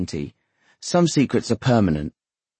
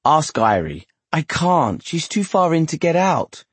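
higher-pitched, insistent excuse; exasperated protest; 8 semitones higher than usual. A narrator's reading. Couple arguing over who should go, exasperated. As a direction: push the job away, pitch rising sharply.

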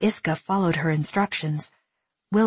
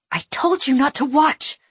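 louder, higher sharp scold; 8 semitones higher, 5 dB louder than usual. A narrator's reading. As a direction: snap at him for looking. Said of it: "Willa scolded him".

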